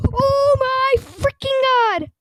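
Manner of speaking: loud, high, slow-stretched exclamation; ecstatic shock. Real, not acted. Streamer just got the rare item, ecstatic shock. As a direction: cry it out high and loud, dragging the words. Trade comes through.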